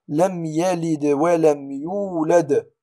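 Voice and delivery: "لم يلد ولم يولد" recited slowly, each final d bounced; قلقلة الدال. Shown as a demonstration. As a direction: lightly echo the d ending "يلد" and "يولد". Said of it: "هذه قلقلة صغرى". (تلاوة "لم يلد ولم يولد" ببطء مع قلقلة الدال)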